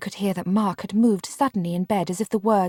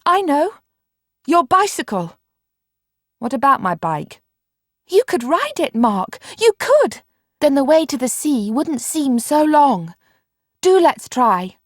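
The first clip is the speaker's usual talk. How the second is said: much higher, louder eager outburst; excited; 7 semitones higher, 6 dB louder than usual. A narrator's reading. Marianne to Mark about the bike, excited and much higher. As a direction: burst with the new idea. Marianne's sudden bicycle idea.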